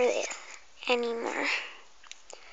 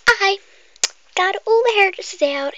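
loud and higher, bragging; boastful loud; 6 semitones higher, 9 dB louder than usual. Acted. Hairdresser loudly boasts to the customer. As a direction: proudly belt it out.

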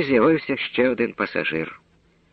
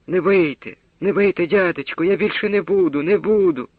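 groaning plea; 7 semitones higher than usual. A narrator's reading. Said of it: "і той застогнав".

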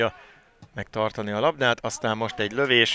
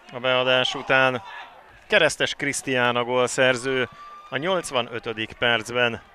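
usual speaking voice

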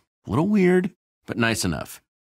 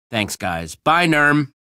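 ordinary delivery

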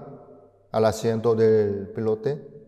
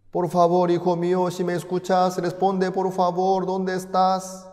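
anxious father calling; 9 semitones higher than usual. Acted. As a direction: cry out for a lost son over the radio. Father keeps calling son.

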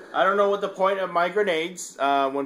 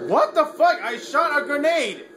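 outraged shout; 8 semitones higher than usual. Real, not acted. Gamer protesting his grenade did nothing. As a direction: yell it at the game.

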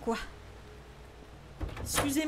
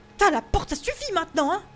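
loud, higher annoyed yell at the door; exasperated scared shout; 5 semitones higher, 7 dB louder, faster than usual. Real, not acted.

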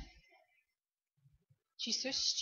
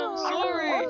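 loud apology; 7 semitones higher, 6 dB louder than usual. Acted. Apologizing loudly to game character.

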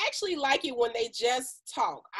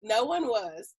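ordinary delivery

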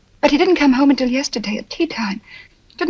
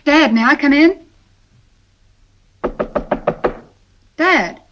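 raised voice, calling through a door; 3 semitones higher than usual. Acted. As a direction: call to him through the door.

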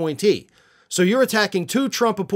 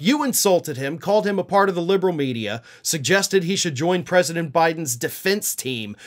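ordinary talk

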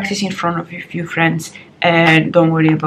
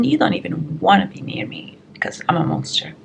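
hushed, unvoiced aside about being a monster when hungry; whispered joke; 2 semitones lower, 6 dB quieter than usual. Joking to viewers about hunger, whispered aside. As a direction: drop to a teasing whisper.

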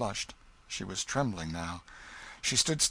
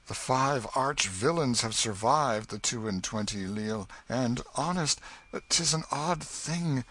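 usual speaking voice

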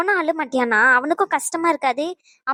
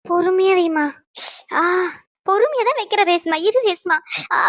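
pained cry. Acted. Injured woman in pain.

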